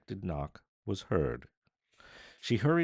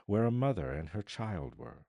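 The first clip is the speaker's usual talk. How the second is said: plain reading voice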